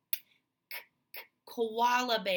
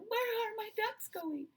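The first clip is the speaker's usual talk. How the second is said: higher, fretting mother; worried mommy duck; 9 semitones higher, 4 dB quieter than usual. Retold or imitated. Teacher voicing the worried mommy duck, higher pitch. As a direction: ask it like a worried mom. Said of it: "Mommy is saying".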